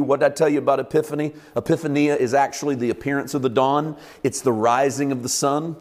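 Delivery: ordinary delivery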